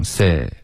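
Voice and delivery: "sit" ending loosely, tongue tip not closing the t; natural stop. Retold or imitated. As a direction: let "sit" trail off without a firm t. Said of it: "你刚刚发的是一个自然停".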